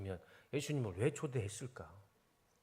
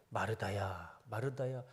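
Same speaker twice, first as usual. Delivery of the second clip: tender calling; 5 semitones lower, 7 dB quieter, slower than usual. Acted. Jesus tenderly calls hurt Martha.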